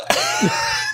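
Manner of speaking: very high, helpless squeal of laughter. Real, not acted. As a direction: break into a shrieking giggle. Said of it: "It's so funny".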